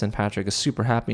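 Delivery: normal talking voice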